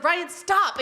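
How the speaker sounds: retold scolding. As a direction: tell off a husband, half in jest. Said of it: "And then I was like".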